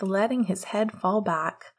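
usual speaking voice

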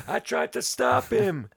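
gasping, high, slow mock panting; out of breath from the chase; loud, hammed-up breathless excuse; winded guard. Finally catches up.

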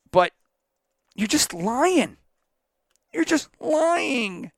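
rising from firm to a high shouted accusation; building accusation. Real, not acted. Calling out the coach for lying, building to a high shout. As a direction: build it up until you are yelling it.

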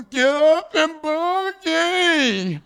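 very high, drawn-out strained holler; long high cry sliding down; mock wail from the cactus. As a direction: imitate a stuck friend wailing his catchphrase. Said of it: "And he was".

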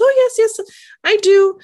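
high, chirpy insistence; sing-song Bunny. Retold or imitated. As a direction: chirp it like an eager pest. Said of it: "And she goes".

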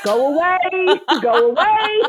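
waving her away through laughter; laughing go away. Retold or imitated. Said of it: "He's like".

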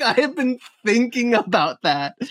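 normal talking voice

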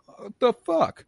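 high, loud cry of disbelief; mock bewilderment. Audience member sees his piano become cardboard, mock bewilderment. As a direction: imitate a stunned onlooker, pitch shooting up.

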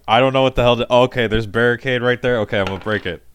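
loud, rambling panic, settling into a decision; a touch of desperation, talking himself through it; desperate, sick character. Acted. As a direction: think out loud, loudly, then resolve. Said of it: "He's desperate, he's sick".

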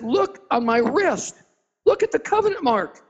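urgent appeal. Retold or imitated. Jesus shows his wrist.

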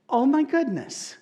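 high, slow shocked oh my goodness; dismayed exclamation. Real, not acted. Pastor reacting to a congregant's story, high slow dismay. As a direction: let the shock climb and linger.

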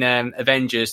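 usual speaking voice

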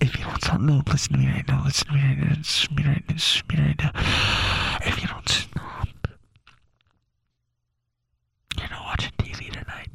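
forceful, relentless mock stage whisper; nonstop mom whisper. Imitating mom who never stopped talking, relentless whisper. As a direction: whisper threats without letting up. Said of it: "she, like, would never stop talking".

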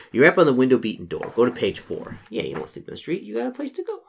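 usual speaking voice

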